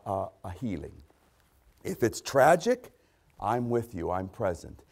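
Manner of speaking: usual speaking voice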